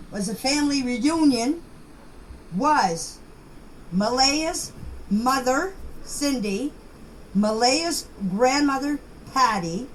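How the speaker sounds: ordinary delivery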